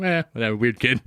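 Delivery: mild offhand shrug in the voice; nonchalant. Retold or imitated. Dad dismissing son's episode to mom, nonchalant. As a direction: imitate a dad brushing it off. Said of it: "Very nonchalant?".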